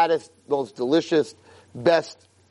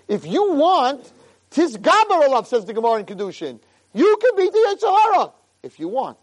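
high, loud preaching push; rallying; 6 semitones higher, 3 dB louder than usual. Acted. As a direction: drive it home to the congregation. Preacher rallies the listeners.

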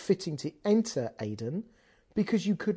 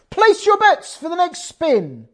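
loud, high croupier call; 12 semitones higher, 11 dB louder, slower than usual. Retold or imitated. Croupier calling to Kevin across the table, loud and high. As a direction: call the bets across the casino floor.

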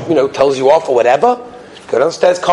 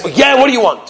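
impatient, higher-pitched retort; bad-mood reply; 6 semitones higher than usual. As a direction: act out a grumpy customer. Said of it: "He's in a bad mood".

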